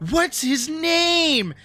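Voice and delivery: high, slow mock-gushing question; gushing coworker. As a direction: ask like you're melting over a kitten. Coworkers gush over cat.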